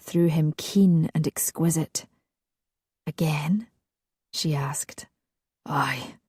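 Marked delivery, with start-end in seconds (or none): 3.1-3.7 breathy whispered lovers' exchange. Acted. Lovers asking and answering, whispered. 4.3-5.1 the tag line whispered too; whispered narration. A narrator's reading. Narrator carrying the lovers' whisper. 5.6-6.2 breathy whispered lovers' exchange. Acted. Lovers asking and answering, whispered.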